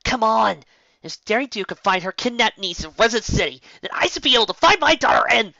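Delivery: loud, high, exasperated yell; frustrated shout. Acted. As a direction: shout it out in frustration while searching. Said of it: "Rainbow groaned out loud".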